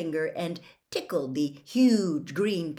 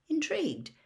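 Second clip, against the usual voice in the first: ordinary delivery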